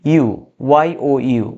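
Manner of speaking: ইউ said slowly as "yoo", then spelled ওয়াই ও ইউ; Y-sound demonstration. Shown as a demonstration. Teacher proves to students it starts like Y, ইউ slowly sounded as "yoo". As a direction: slowly draw out ইউ with a Y glide, spell it out. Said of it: "ওয়াই এর উচ্চারণটা হচ্ছে এখানে দেখো".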